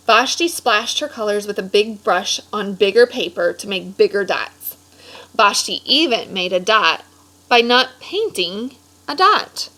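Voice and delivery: lively storybook read-aloud voice; animated picture-book narration; reading to children. A narrator's reading. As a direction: read it like story time for a class.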